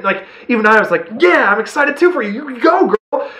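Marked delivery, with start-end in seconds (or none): 1.2-3.3 much higher, gushing cheer; excited cheering. Retold or imitated. Moviegoer cheering the character's big win, high and excited. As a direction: cheer her on from your seat. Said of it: "She's excited".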